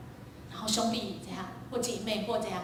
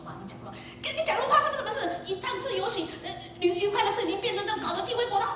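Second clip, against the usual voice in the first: much higher, fast complaining; exasperated mother; 10 semitones higher, faster than usual. Retold or imitated. Mother complaining to the father that the trip became chaos, exasperated.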